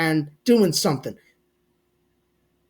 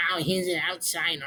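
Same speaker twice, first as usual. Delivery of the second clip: mock whiny fan; 8 dB quieter than usual. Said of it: "nobody was whining about that, going".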